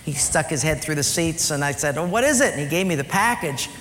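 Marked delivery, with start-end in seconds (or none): 2.0-2.5 eager question. Retold or imitated. Father's eager reply voiced.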